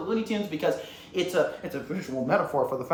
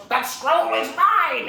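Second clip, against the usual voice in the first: high, loud, drawn-out villain cry; hammed-up movie villain shout; acted villain's demand; 8 semitones higher, 7 dB louder, much slower than usual. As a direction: claim it with a slow, loud roar. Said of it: "and he goes".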